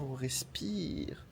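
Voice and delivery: slow whispered breath. Real, not acted. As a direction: whisper it, slowing down.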